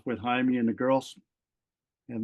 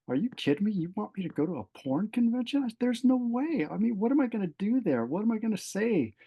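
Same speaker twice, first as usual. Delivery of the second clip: high-pitched, disbelieving retort; retold exasperated objection, pitched well up; incredulous protest; 9 semitones higher than usual. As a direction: argue back in rising disbelief. Retold protest at God's request.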